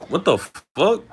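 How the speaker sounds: high, loud what-the-fuck; stunned and outraged; shocked outburst. Real, not acted. Reacting to racist comment.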